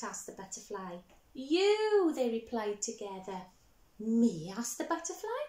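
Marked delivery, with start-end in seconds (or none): 1.3-2.1 much louder and higher; all shout it together; chorus shout. Acted. 4.0-4.5 louder startled question; surprised me. Acted.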